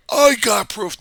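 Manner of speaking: high, shouted mock complaint; mock protest. Election denier insisting to a doubter, high mock shouting. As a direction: yell it like a stubborn objector. Acted quarrel over proof.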